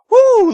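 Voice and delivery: high shrill woo, full volume; forced cheer. Shown as a demonstration. Woo to his viewers, whooped at full volume. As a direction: whoop it out like a hype host. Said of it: "I'm trying to do this enthusiastically".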